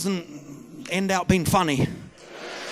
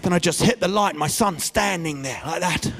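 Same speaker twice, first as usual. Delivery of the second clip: usual speaking voice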